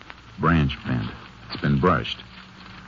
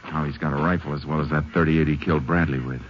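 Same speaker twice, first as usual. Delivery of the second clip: normal talking voice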